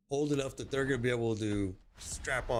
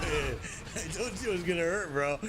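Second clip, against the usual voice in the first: amused, laughter breaking round the words; laughing; 9 semitones higher than usual. Real, not acted. Streamer to chat after getting hurt, laughing. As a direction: say it through a laugh, pitched up.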